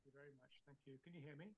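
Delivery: faint remote line; far-off, barely heard over the call; barely audible. Real, not acted. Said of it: "Your voice is very low".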